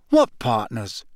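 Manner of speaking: a touch of confusion; Harry, puzzled. A narrator's reading. Harry asks his teacher what partners, mildly puzzled. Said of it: "Harry puzzled reply".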